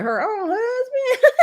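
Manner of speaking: high, slow, laughing through it; Tisha laughing disbelief. Retold or imitated. Tisha stunned by the claim, slow high laughing disbelief. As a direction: stretch it out high in laughing shock. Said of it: "Even Tisha was like".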